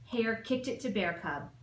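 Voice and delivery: plain reading voice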